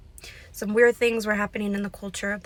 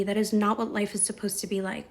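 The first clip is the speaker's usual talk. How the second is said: usual speaking voice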